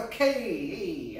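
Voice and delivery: high, slow, drawn-out backing down; placating onlooker. Acted. Onlookers turning polite around guns, high drawn-out appeasing.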